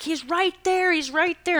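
high, urgent repeat; urgent pointing. Retold or imitated. Tells security guards where the man is, high urgent.

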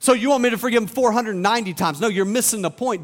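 ordinary talk, nothing marked

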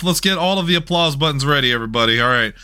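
normal talking voice

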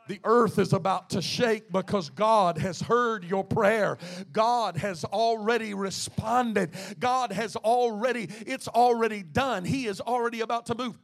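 loud, fervent preacher's declaration; rising preaching. Acted. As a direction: declare it to the church with fire. Preaching climax to congregation.

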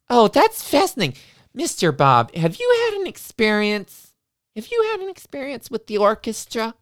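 high, syrupy sing-song teacher; sugary teacher voice. Retold or imitated. Teacher welcoming the kids to music class, high and syrupy. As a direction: gush over the children, sweetly high.

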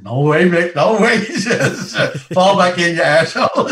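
high, loud, joking shout; loud laughing banter. Retold or imitated. Sergeant and corporal joking loudly in front of ranks. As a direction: roar it with a laugh.

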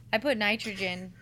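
ordinary talk

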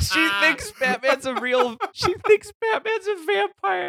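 high, slow, laughing disbelief. Real, not acted. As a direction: squeal it through laughter.

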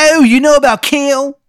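high, loud, hammed-up Maryland accent; overdone Maryland accent, loud and high; terrible Maryland accent. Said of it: "His accent is so bad".

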